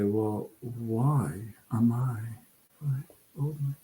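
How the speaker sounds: mocking whispered excuse. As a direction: breathe it out in a whisper. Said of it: "you say".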